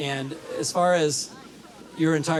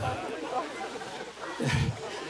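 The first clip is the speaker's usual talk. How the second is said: breathy laugh; 9 semitones higher, 8 dB quieter than usual. Real, not acted. Laughing at own joke.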